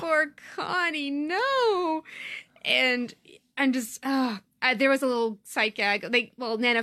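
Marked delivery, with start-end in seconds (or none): 0.0-2.0 mock-mournful lament. Real, not acted. Lamenting a character's broken sword.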